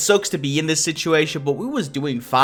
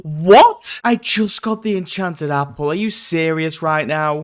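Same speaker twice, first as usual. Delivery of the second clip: usual speaking voice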